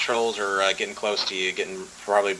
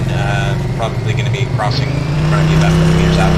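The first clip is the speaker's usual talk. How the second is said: usual speaking voice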